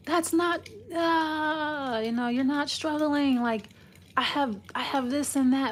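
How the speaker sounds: mock flip-out. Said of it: "flip out and go".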